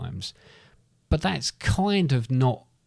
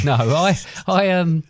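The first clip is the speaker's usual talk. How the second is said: laughing; 6 semitones higher, 5 dB louder, slower than usual. Real, not acted. Laughing mid-sentence.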